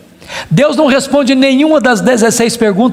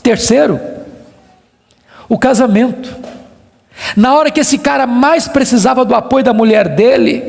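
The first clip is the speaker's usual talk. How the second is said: usual speaking voice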